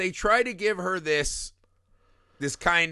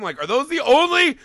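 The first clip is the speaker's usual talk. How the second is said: high, loud exasperated rant; outraged yell; 11 semitones higher, 7 dB louder than usual. Retold or imitated. Viewer yelling at the mom on screen, outraged. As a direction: bellow the question in disbelief.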